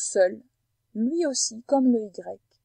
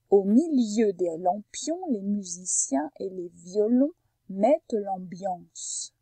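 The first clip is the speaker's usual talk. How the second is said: slow, deliberately articulated sentence; careful listening example; slower than usual. Shown as a demonstration. Teacher repeats the sentence for sound-hunting, slow and careful. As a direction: draw out each word so sounds stand out. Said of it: "écoute bien et cherche".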